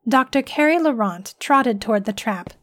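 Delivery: ordinary delivery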